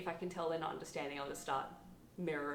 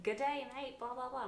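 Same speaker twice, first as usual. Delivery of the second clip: put-on higher-pitched caricature of an Australian accent; mock Australian accent; 6 semitones higher than usual. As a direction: imitate someone badly doing an Australian accent. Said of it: "trying to do my accent will be like".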